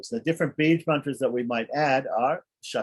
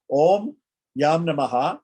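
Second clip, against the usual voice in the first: slow mantra with a lifted Om; slow intoned mantra; 3 semitones higher, 5 dB louder than usual. Shown as a demonstration. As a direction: chant it slowly and clearly. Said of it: "recites the mantra".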